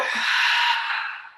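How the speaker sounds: loud whooshing breath out; demonstrated big exhale. Shown as a demonstration. Yoga teacher releasing a held breath with the class, big exhale. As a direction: let the held breath go forcefully. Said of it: "and then let it go".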